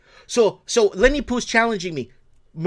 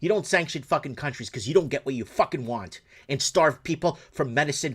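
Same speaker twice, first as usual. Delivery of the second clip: usual speaking voice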